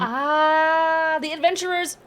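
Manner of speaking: high, knowing gnome voice; pleased gnome. Acted. Gnome recognizing the adventurers, high and pleased. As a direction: realize who they are with delight.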